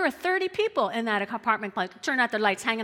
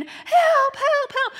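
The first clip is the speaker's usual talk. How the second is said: high piercing yelled "Help", much louder; retold scream for help; 10 dB louder, much slower than usual. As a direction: scream "Help, help, help" like a victim under attack. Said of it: "and she's screaming".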